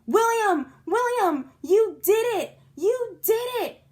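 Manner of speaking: high, loud cheering; joyful holler. A narrator's reading. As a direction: cheer him like a hero. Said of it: "we hollered real joyful".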